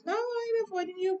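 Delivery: high, quick mock denial; Fatima denying. Fatima brushing off her friend, high and quick. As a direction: toss off a squeaky, hurried denial. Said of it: "And Fatima's like".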